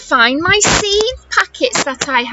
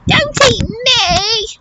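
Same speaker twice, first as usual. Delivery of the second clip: mock shout; 5 semitones higher, slower than usual. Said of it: "I shout".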